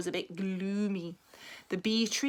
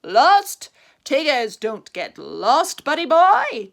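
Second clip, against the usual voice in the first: loud, slow breezy boast; cheerful Tigger; 3 semitones higher, 12 dB louder, slower than usual. A narrator's reading. Tigger to Rabbit, loud cheerful brag. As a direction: brush it off cheerfully. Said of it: "Tigger cheerfully replied".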